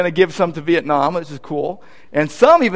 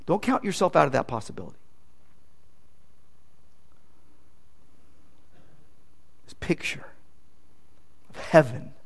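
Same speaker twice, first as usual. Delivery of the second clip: hushed awe; 6 semitones lower, 13 dB quieter than usual. Real, not acted. Awe at the picture of heaven.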